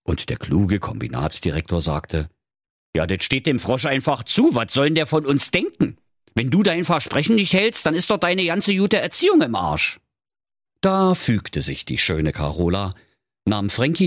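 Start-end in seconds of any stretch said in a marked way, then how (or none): 3.0-10.0 raised-pitch lecturing father; insistent fatherly character voice. Acted. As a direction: lecture your daughter on her manners, pitched up.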